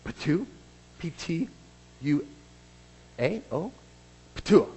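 "ptua" said like a short spit; Greek word demonstration. Shown as a demonstration. Preacher teaching the congregation a Greek word, demonstrating "ptua". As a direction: sound out "ptua" as a spitting noise. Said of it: "a new Greek word for you today".

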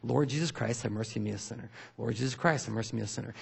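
soft, even repeated prayer; quiet fluent repetition. Shown as a demonstration. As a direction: run the prayer quietly like a habit. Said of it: "out loud, using their vocals".

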